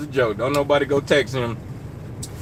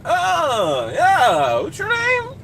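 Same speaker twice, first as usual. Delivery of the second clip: dismissive fan; 12 semitones higher, much slower than usual. Retold or imitated. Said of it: "Now y'all be like".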